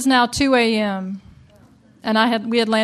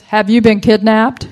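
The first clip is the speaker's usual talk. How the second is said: panicked shout; 10 dB louder than usual. Retold or imitated. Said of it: "all I hear is".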